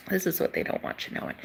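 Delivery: fast, soft whispered secret; quick hushed secret-telling; conspiratorial whisper. Real, not acted. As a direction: lean in and whisper the forbidden part. Revealing a hidden truth.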